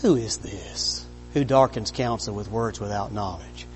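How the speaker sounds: low, soft, even question; quiet and calm. Shown as a demonstration. Preacher showing God asking Job quietly, hushed. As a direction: ask it gently, almost under your breath. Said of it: "he said it more like".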